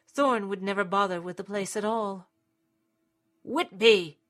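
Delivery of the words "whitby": loud call down the stairs. A narrator's reading. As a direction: call his name sharply. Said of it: "he called out again".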